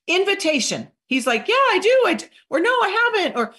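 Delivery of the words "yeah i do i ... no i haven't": high, loud, keen answer; eager prospect reply. Acted. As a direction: act out a perky customer answering.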